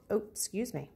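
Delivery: ordinary talk, nothing marked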